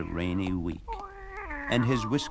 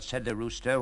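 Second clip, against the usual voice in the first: ordinary delivery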